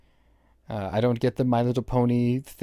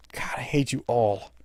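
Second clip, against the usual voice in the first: whispered, fed-up aside; exasperated whisper; 3 dB quieter than usual. Real, not acted. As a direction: breathe it out under your breath. Muttering at game characters.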